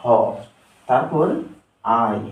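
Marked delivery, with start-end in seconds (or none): 0.0-0.1 হ said alone as H's sound; letter sound demo. Shown as a demonstration. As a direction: sound out হ as a full syllable. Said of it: "এইচ এর সঠিক উচ্চারণ হলো". (হ আলাদা করে উচ্চারণ)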